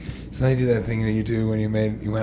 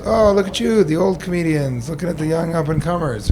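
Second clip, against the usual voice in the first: mocking comic; 6 semitones higher than usual. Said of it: "He goes".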